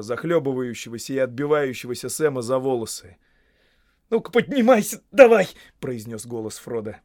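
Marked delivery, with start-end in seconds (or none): 4.1-5.5 loud, high urging; pressing rescue call; urgent shout. A narrator's reading. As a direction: shout to haul a drowning friend up. Urging a drowning friend up.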